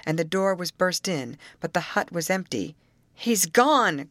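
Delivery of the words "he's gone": high, loud cry of dismay. A narrator's reading. Searcher finding his friend's hut empty, crying out. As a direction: exclaim in alarm. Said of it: "cried the loon".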